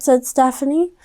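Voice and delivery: ordinary delivery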